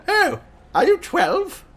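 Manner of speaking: high, slow, rising grandma question; puzzled grandmother. Acted. Grandma questioning a stranger at the door, puzzled.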